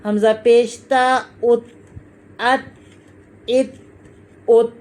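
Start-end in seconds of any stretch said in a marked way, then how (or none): 1.4-4.8 "अत, इत, उत" with a light t held shut; "अत, इत, उत" with closed endings; t without bounce. Shown as a demonstration. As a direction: stop each t of "अत, इत, उत" without echo. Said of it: "ये नहीं हिलेगी".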